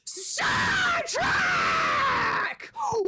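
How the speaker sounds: very high, drawn-out victory screams; celebratory yelling. Real, not acted. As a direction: scream it to an empty house in triumph. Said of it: "I get to be the one that yells".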